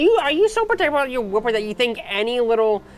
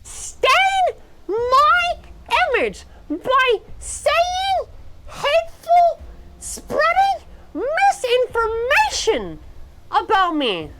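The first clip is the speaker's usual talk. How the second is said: mock outrage; 8 semitones higher than usual. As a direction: declare war in mock fury.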